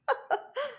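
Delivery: a small high sad laugh; sad little laugh. Acted. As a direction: laugh sadly, briefly. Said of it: "fit un petit rire triste". (petit rire triste)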